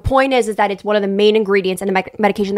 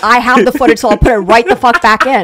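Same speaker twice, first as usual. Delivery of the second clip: louder, defiant comeback; loud insistent; 4 semitones higher, 11 dB louder than usual. Real, not acted. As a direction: shout the threat back. Threatening to restore footage.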